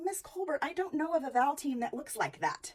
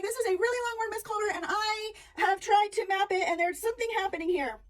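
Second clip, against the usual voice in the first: put-on higher, pleading kid voice; puzzled student; 8 semitones higher than usual. Student bringing a long word to the teacher, puzzled and higher. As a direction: sound like a child stuck on a long word.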